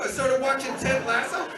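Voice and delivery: normal talking voice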